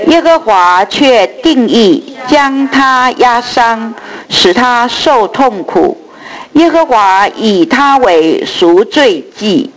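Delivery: slow solemn group recitation; scripture reading. Real, not acted. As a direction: read the verses slowly together. Congregation reads scripture aloud. (慢速齐声读经)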